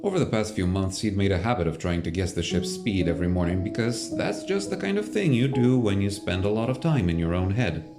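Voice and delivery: usual speaking voice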